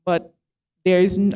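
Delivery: ordinary delivery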